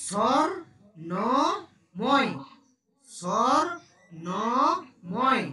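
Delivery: স্বর্ণময় stretched out slowly, syllable by syllable; slow careful pronunciation. Shown as a demonstration. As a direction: spell out স্বর্ণময় slowly for a pupil. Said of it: "আমার উচ্চারণ দেখবি".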